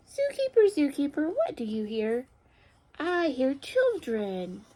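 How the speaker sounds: high call on the animal name, slow answer; picture-book read-aloud. A narrator's reading. Teacher reads animal story to children, expressive storytelling. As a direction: call the animal, then stretch the sound word.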